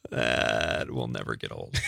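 low, slow growling pirate voice; hammed-up pirate growl. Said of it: "Talk Like a Pirate Day".

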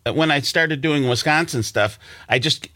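usual speaking voice

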